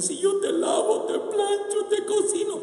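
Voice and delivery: high, loud sing-song whine; mock the nagging wife, sung out; mocking complaining wife. Preacher mocks a wife listing her chores, sing-song and high. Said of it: "No haga como aquellas que dicen".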